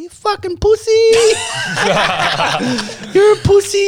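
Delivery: put-on high, laughing, taunting kid voice; mocking kid brother. Brother mocks the other after Dad leaves, high and laughing. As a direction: sneer it like a kid mocking his crying brother.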